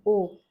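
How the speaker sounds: loud, drawn-out vowel O; vowel demo. Shown as a demonstration. Teacher sounding out O loudly for students. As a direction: model the O for learners. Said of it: "The next vowel is O".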